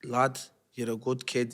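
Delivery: put-on slow, soft Liverpool accent; Scouse accent. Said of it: "My Scouse accent's all right".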